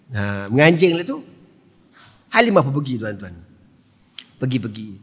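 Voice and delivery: ordinary talk, nothing marked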